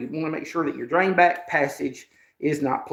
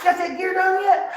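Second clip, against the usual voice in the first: high, louder teasing friend; quoting Randy's question; 5 dB louder than usual. Retold or imitated. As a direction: toss it out like a friend ribbing you. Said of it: "He's like".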